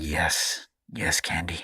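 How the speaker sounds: very low, deep mock-sultry voice; mock-seductive Dipper. Dipper answering Candy, hammed-up deep voice. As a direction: drop it way down, hammed up. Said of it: "That's what he said".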